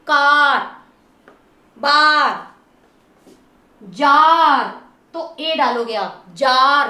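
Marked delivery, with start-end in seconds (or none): 0.1-0.6 कार loud, held on the "aa" so it is heard; long aa. Shown as a demonstration. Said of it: "ध्यान से सुनिए". 1.8-2.5 बार loud, with a drawn-out "aa"; long aa. Shown as a demonstration. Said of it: "आ का sound आ रहा है". 3.8-4.6 जार loud, the "aa" held long. Shown as a demonstration. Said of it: "आ का sound आ रहा है". 6.3-6.9 जार loud with a lengthened "aa", pitched lower; long aa. Shown as a demonstration. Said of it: "बड़े आ के sound".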